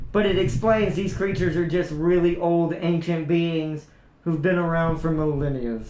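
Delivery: ordinary delivery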